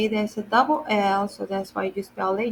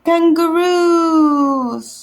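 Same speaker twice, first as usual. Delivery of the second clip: drawn-out announce; 6 semitones higher, 7 dB louder, much slower than usual. Shown as a demonstration. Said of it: "announcing new word".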